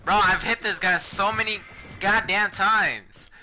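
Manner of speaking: furious high yelling; screaming in frustration; raging gamer. Real, not acted. Losing a fight, yelling.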